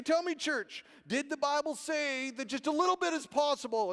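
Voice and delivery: high-pitched preacher calling for response; imitated preacher. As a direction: work up the congregation. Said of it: "And then he'd say".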